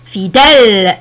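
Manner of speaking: "fidèle" with a loud, lengthened open è. Shown as a demonstration. Teacher pronouncing for learners, "fidèle" with long open è. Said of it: "Je prononce".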